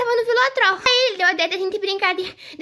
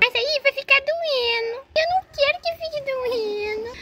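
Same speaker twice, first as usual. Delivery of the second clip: whiny protest; 4 semitones higher than usual. Acted. Boy objects to mother washing cut, whining. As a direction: whine in dread of pain. Boy fears the pain will last.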